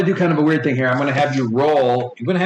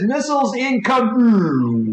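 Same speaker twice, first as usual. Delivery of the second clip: drawn-out, higher missile alert; urgent alarm; 6 semitones higher, slower than usual. Acted. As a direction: stretch the warning out like an alarm. Ship AI sounds the alarm.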